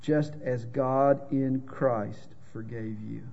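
slow and soft, each word given heavy weight; a quiet, sobering stress on the hard part of the verse; weighty emphasis. Shown as a demonstration. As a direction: let "Just as God in Christ forgave you" land slowly. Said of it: "But it goes on".

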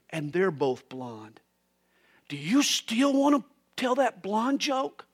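hushed, voiced imitation of a whisper; mock whisper. Friend leaning over to warn the blind guy, mock whisper. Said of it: "leans over and he whispers to him".

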